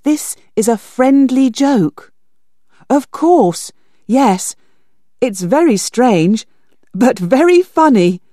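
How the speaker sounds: B Z treating it as a joke, hearty; forced cheer. Acted. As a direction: laugh it off. B Z laughs it off.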